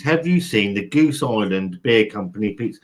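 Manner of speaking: ordinary talk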